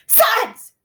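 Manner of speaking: sharp, high, loud shout; shocking yell. A narrator's reading. Mother jolting her son awake, piercing shout. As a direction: hit it like a smashed window. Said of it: "the voice was shocking, like a smashed window".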